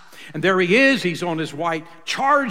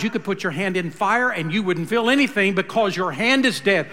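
ordinary delivery

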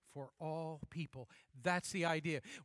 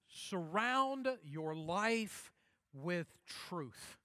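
slow solemn; much slower than usual. Acted. Preacher intones key phrase.

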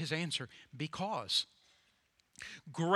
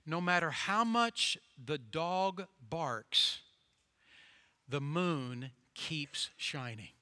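slow wise farmer; much slower than usual. Acted. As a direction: let the lesson land, slow.

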